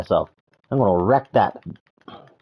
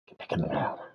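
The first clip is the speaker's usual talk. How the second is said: much lower, much softer gruff voice; deep, quiet creature order; low growled command; 9 semitones lower, 13 dB quieter, faster than usual. Pretend. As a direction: order it back down in a deep monster voice. Repeated in low far voice.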